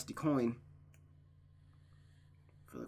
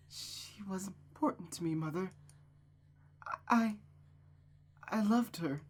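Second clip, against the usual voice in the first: higher, soft and halting with grief; grieving daughter; 6 semitones higher, 4 dB quieter than usual. Acted. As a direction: let it catch on the I. Rosa grieving her friend.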